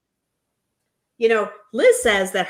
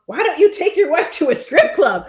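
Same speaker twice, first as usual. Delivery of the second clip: higher, louder mock challenge; retold jab; 8 semitones higher, 6 dB louder than usual. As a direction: throw the jab out high and loud, amused. Acts out Ed's jab at Jovi.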